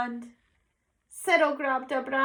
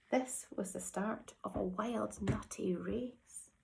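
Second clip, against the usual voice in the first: whisper; 4 semitones lower, 12 dB quieter than usual. Acted. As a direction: breathe it like a secret. Whispered suspense in story.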